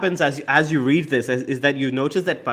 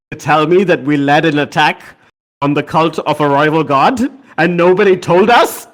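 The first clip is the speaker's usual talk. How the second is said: mild outrage, building louder to the end; indignant; 4 semitones higher, 6 dB louder than usual. Acted. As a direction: demand an answer in rising disbelief. Indignant confrontation over secret attack.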